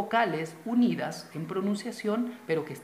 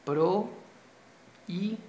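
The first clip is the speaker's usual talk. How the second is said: "prohíbe" in slow, separate syllables; split into syllables; 3 semitones higher than usual. Shown as a demonstration. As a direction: break "prohíbe" into pro-í-be with long pauses. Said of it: "la voy a separar".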